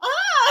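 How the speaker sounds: a breathy little moan, hammed-up; mock horny oh. Host mimics crying woman's horny noise, hammed-up moan. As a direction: imitate her aroused gasp mid-sob. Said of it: "she makes a little horny noise".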